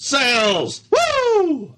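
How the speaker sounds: high, whooping cheer; cheering yell. Pretend. Player cheers the new sails, high cheering yell. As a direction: whoop it up in mock triumph. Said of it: "Just yelling".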